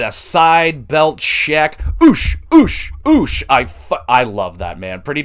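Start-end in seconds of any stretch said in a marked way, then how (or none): 2.0-3.4 "oosh" squeaked high and loud; high mock cheer. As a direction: hype it like a goofy crowd chant. Mimicking wrestlers' belt-check cheer.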